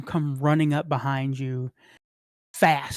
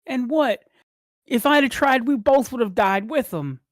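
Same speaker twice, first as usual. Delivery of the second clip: high, heated pleading argument; upset retort; 8 semitones higher, 3 dB louder than usual. Acted. Brother argues they would both have died, high and upset.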